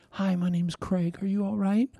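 approach a crying stranger softly; tender, careful greeting, rising at the end; gentle concern. Retold or imitated.

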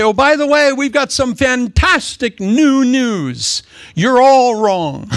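high, loud proclamation, slowing to a crawl; gleeful mock announcement. Preacher playing a newcomer addressing a Roman orgy, gleefully announcing. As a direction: draw out the big news and land it loudly.

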